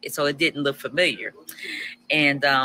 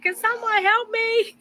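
high, slow mock cry for help; stranded wife retelling her yell for help, high pitched; retold yell for help. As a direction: call out for help, half laughing. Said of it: "I started yelling".

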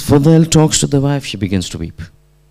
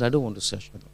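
retold weak refusal; 2 semitones lower, 13 dB quieter, much faster than usual. Dying student refusing his teacher's prompt, quiet refusal.

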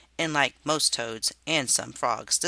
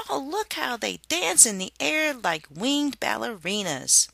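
high, rapturous sing-song; high dreamy lady voice; gushing Harriet; 9 semitones higher than usual. A narrator's reading. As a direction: swoon at the dancing insects. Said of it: "she exulted".

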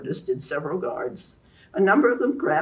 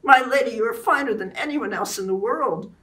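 higher, louder ardent praise; fervently; 7 semitones higher, 5 dB louder than usual. A narrator's reading. Lancelot declaring love for Guinevere, higher fervent praise. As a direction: pour out devotion. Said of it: "Lancelot said fervently".

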